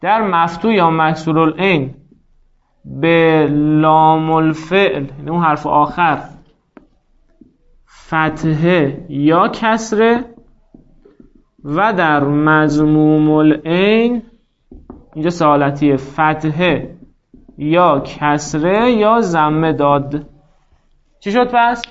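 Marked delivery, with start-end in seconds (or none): 2.8-5.0 very slow, word-by-word dictation with long pauses. Shown as a demonstration. Teacher dictating rule for writing. 8.1-14.2 very slow, word-by-word dictation with long pauses. Shown as a demonstration. Teacher dictating rule for writing. 16.0-20.2 very slow, word-by-word dictation with long pauses. Shown as a demonstration. Teacher dictating rule for writing.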